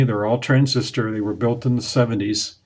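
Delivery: ordinary talk, nothing marked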